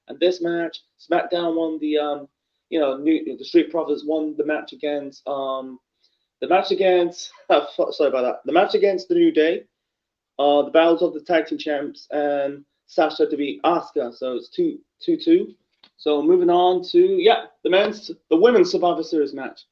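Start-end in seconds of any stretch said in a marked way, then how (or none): none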